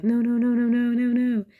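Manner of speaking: high, loud mock panic; frantic string of refusals, hammed up; pushing down tears. Speaker trying to stop oncoming tears, mock panicked. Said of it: "you try to push it down".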